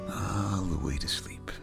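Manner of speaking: whispered, fading all the way down; whispered bedtime. Acted. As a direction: breathe it out as a whisper. Bedtime lullaby close.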